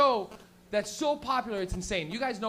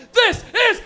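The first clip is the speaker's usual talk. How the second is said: high, very loud mock announcer yell; shouted intro; 12 semitones higher, 13 dB louder than usual. Art show intro reaching its climax, shouted high. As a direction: blast out each word of the title.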